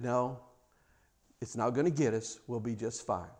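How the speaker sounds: hushed tender comfort; gentle reassurance. Retold or imitated. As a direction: soothe a frightened child. Grandmother calming child.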